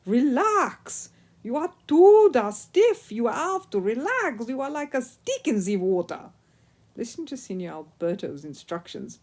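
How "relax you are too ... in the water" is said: exasperated Italian instructor, higher and louder, slow; Italian ski instructor. A narrator's reading. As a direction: coach a hopeless pupil in a thick Italian accent. Signor Alberto voiced with Italian accent.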